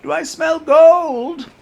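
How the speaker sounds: put-on high, slow, loud miserly old duck; Scrooge voice. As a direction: savor the gold like a greedy miser. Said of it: "gonna have a hard time getting into that voice again".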